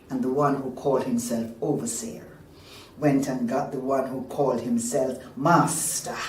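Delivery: ordinary delivery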